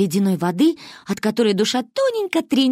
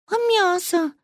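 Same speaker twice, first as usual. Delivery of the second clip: put-on high, small childish squeak; 12 semitones higher, slower than usual. A narrator's reading. Manya squeaking a question to her grandmother. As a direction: squeak it like a timid little girl. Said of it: "пискнула Маня".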